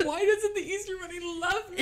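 high, loud wail through laughter; hammed-up heartbroken kid; mock wailing child. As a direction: pretend to bawl while laughing. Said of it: "You're like".

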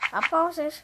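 ordinary talk, nothing marked

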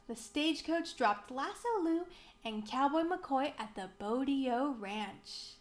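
ordinary delivery, nothing marked

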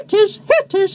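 high little bird call; spy bird. A narrator's reading. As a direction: chirp it twice, high. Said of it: "said a spy".

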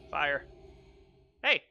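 ordinary talk, nothing marked

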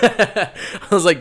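laughing through the words, slow; cracking up mid-sentence. Real, not acted. Laughing at his own story while telling a friend.

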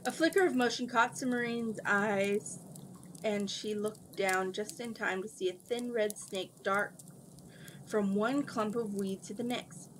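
usual speaking voice

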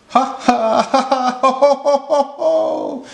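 high ha-ha rolling into ho-hos; storybook elephant guffawing; acted belly laugh. A narrator's reading.

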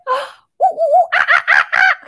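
very high loud monkey hoots after a gasp; gasp, then hoot like a wild monkey; monkey call. Pretend. Teacher hooting like a monkey for the kids, high and loud. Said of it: "they always say".

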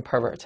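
pervert with the stress up front; noun stress on pervert. Shown as a demonstration. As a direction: stress the first syllable of pervert. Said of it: "名词读成".

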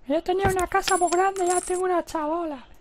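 very high put-on lament; complaining character. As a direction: squeal it as a hard-done-by game character. Voices the complaining game character. (voz aguda de personaje quejica)

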